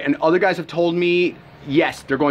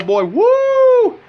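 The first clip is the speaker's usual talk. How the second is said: put-on a sudden high falsetto "boy, woo", louder; mock whoop; 7 dB louder than usual. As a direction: whoop way up high like a rollercoaster drop. Mimics the energy crash coming.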